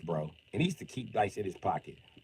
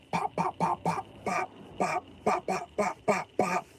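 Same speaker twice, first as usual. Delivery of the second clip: mock button pops; 11 semitones higher, slower than usual. Imitating button presses.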